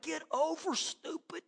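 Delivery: high, hushed mock exclamation; imitated exasperated driver. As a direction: mouth it at another driver, high and under the breath. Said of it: "she's looking at me like".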